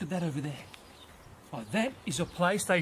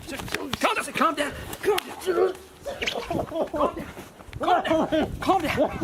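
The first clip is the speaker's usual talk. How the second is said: higher and louder with each repeat, losing control; rising frantic calm down; 11 semitones higher, 3 dB louder, slower than usual. Acted. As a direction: beg the animal to settle, then yell it. Pony struggling, host wrestling.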